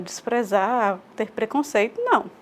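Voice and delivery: ordinary talk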